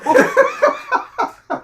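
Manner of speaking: squealing laugh; high burst of laughter. Real, not acted. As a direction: let out a high shriek of laughter.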